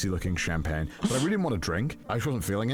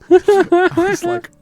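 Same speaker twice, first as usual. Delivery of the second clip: laughing; 16 dB louder, much slower than usual. Real, not acted. Laughing at absurd news.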